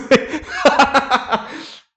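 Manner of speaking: long rire aigu et sonore; éclat de rire. Real, not acted. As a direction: ris franchement de ta gaffe. Rit de sa gaffe. (grand rire bruyant)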